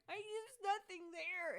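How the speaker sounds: high, loud, drawn-out wail; hammed-up exasperated cry; frustrated guard. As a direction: protest helplessly, close to tears. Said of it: "the frustrated guy is just like".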